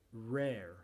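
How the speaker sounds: American "Rare" with both Rs sounded; American pronunciation. Shown as a demonstration. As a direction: say "Rare" the Yankee way. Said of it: "con la pronunciación yankee".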